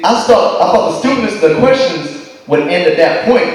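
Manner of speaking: ordinary delivery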